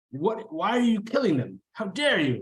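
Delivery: high, indignant accusation; outraged high elf. Acted. Elf accusing the party of killing, high and indignant. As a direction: demand it up high, offended.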